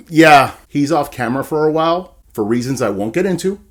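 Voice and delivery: usual speaking voice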